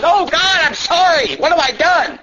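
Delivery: high, pleading cry to God; mock anguished sinner; repentant sinner. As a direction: beg God for mercy. Sinner voiced repenting before God.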